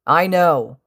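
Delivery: loud angry retort; yelling. A narrator's reading. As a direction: throw it back as a shout. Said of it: "Kacchan yelled".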